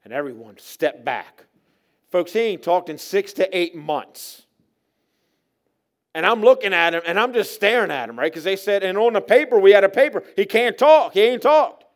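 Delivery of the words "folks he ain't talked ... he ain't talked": loud, high, urgent preaching; raised-voice pulpit testimony; preaching at full force. Real, not acted. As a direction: drive the warning home to the congregation.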